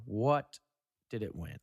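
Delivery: usual speaking voice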